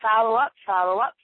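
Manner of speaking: usual speaking voice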